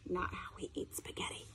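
quick whispered aside. Real, not acted. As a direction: lean in and whisper it. Aside to children.

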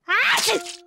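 loud sneeze. Acted. Peppa sneezing.

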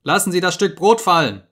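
loud, sharp order from a hidden speaker; cold loud command from the telescreen. Acted. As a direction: bark the command down into the cell. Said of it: "sagte die Stimme".

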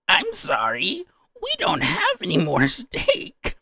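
high, loud, drawn-out cartoon villain; over-the-top waiter villain, stretching the words; anime villain waiter. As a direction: ham it up as a scheming waiter. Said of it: "the response from the, uh, the, the waiter".

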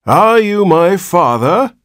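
put-on slow, high, loud old man's croak; cracked ancient voice. A narrator's reading. Newborn old man questions his father, cracked and ancient. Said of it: "spoke in a cracked and ancient voice".

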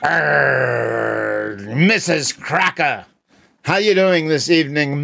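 growly pirate brogue; hammy sea captain, arr matey. Acted. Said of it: "you gotta talk like a pirate".

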